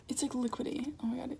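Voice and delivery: whispered disgust. Real, not acted. Disgusted at runny cookies.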